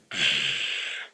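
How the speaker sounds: big breathy sigh after a sip; dramatic sigh. Retold or imitated. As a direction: heave a long contented sigh. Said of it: "and then goes".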